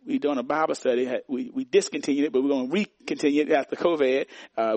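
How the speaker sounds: ordinary talk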